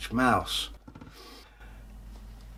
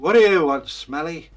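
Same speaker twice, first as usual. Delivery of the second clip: guard's challenge; 5 semitones higher, 8 dB louder than usual. Acted. Castle guard challenges tramp.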